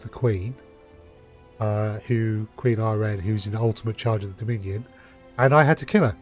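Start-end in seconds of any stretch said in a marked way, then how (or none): none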